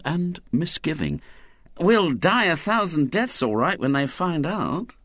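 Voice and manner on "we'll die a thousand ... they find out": a lightly voiced boy Ginger, a touch higher; a worried boy, slightly higher voice. A narrator's reading. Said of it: "said Ginger".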